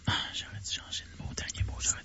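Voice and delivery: regretful whisper aside. Real, not acted. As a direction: whisper it to yourself, annoyed. Regret chuchoté à part. (chuchoté)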